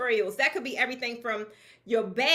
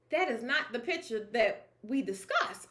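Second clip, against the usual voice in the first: disappointed client; 3 semitones lower, 4 dB quieter than usual. Retold or imitated. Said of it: "they like".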